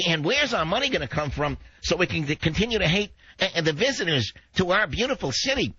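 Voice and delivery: high, hammed-up whine, far above his own voice; mock the left-wingers in a high sneer; mock whining lib. Host mocking San Francisco liberals in a high, hammed-up whine. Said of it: "the libs are screaming".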